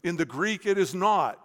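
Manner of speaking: ordinary talk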